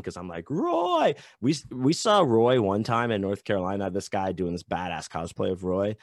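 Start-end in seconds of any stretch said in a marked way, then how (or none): 0.5-1.1 high drawn-out fan call; imitated fanboy cheer; excited fan greeting. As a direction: hail your idol, pitched way up. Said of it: "every time I see them".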